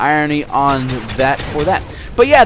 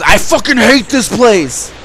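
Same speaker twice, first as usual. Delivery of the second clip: slow, higher, louder bitter grumble; fed-up coworker; 7 semitones higher, 5 dB louder, slower than usual. Retold or imitated. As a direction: voice the coworker dumping his frustration. Coworker suddenly vents at clock-in.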